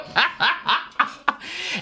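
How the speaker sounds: high-pitched burst of laughter; helpless high cackling laugh; real laughing fit. Real, not acted. Cracks up at own confession.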